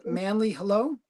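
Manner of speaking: ordinary talk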